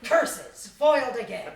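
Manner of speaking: loud thwarted villain snarl; mustache twirling villain. Acted. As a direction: spit it out, beaten villain.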